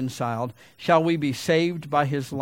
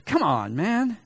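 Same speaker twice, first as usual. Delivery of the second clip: a lightly voiced gentle rebuke; patient heavenly tsk; God, mildly chiding; 4 semitones higher than usual. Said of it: "And God says".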